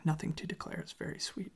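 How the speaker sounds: usual speaking voice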